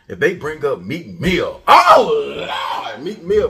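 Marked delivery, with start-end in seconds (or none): 1.7-3.5 loud, high hammed-up wail; mock outcry. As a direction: cry out in mock alarm. Mocking hosts' outcry over [name].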